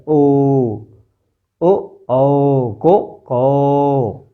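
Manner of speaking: ओ and औ held with rounded lips; कौ drawn out with lips pushed forward; rounded lip demo. Shown as a demonstration. Teacher shows the lip shape to pupils, drawn out. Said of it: "ओठ का मुंह बन रहा है ना ऐसा".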